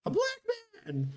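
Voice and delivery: very high falsetto mock scream; mock fright. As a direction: imitate a bear squealing in pretend terror. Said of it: "the bear turns around and goes".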